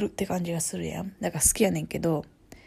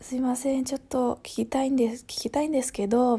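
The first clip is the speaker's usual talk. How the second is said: higher-pitched, polite Tokyo intonation; Tokyo accent; 5 semitones higher than usual. Shown as a demonstration. Kansai speaker demonstrating how Tokyo people ask, in Tokyo accent. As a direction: put on polite standard Tokyo Japanese. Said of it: "こう、東京とか。".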